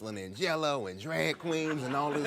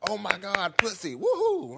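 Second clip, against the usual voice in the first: high, loud cheering crowd; rowdy audience cheer; excited audience whoop; 10 semitones higher, 8 dB louder than usual. Retold or imitated.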